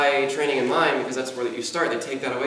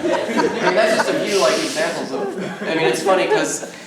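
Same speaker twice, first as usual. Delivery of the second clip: laughing; 3 semitones higher, 5 dB louder than usual. Real, not acted. Laughing over the robot sounds.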